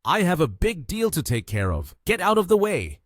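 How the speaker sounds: urgent, high, loud brush-off; loud shout. A narrator's reading. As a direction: bark it out, pushing someone aside. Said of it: "The director immediately shouted loudly".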